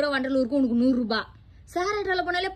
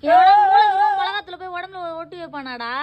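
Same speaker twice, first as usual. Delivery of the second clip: high, loud outburst of disbelief; exasperated yell; 3 semitones higher, 4 dB louder than usual. Acted. Mother appalled at her son's chilli-powder remedy, exasperated yell. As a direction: start at a yell, scolding in disbelief.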